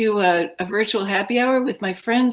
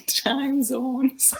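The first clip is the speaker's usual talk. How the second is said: laughing, softer Minnesotan accent with round o's; 3 semitones higher, 6 dB quieter than usual. Shown as a demonstration. Guest performing for host on request, Minnesotan accent. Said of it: "Now say time zone with that accent".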